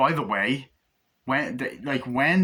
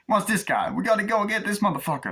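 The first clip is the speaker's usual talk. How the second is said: higher, fast mock tough guy; lightly voiced gangster; 11 semitones higher than usual. Acted gangster dialogue from movie.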